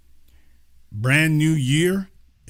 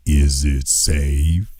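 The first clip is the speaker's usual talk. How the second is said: much lower, slow, menacing "Is it safe?"; Marathon Man quote; 12 semitones lower, much slower than usual. Retold or imitated. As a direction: drag it out low like the dentist villain. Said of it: "Dustin Hoffman in The Marathon Man".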